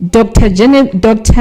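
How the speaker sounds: ordinary talk, nothing marked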